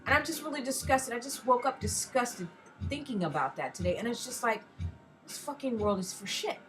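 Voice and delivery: normal talking voice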